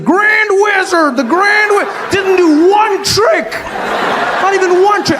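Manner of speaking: high, slow incredulous yelling; hammed-up disbelief, dragged out; mock outraged shout. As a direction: shout it like a cheated magic-show fan.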